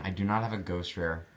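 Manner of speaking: normal talking voice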